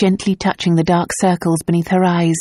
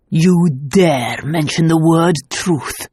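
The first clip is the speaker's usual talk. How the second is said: slow, thick with emotion; slower than usual. A narrator's reading. Nicholas retorts to Luke, voice slow and thick. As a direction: choke it out slowly, stung. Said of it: "Nicholas's voice was suddenly thick".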